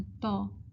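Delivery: تو as a plain "taw"; wrong reading. Shown as a demonstration. Teacher isolating the mistake, single flattened vowel. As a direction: drop the glide from تو. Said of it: "اگر ہم غلط پڑھیں گے".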